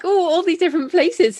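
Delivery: half-playful gasp of wonder at the list; amazed. Retold or imitated. Host reacting to the long island route, playfully amazed. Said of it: "I'm like".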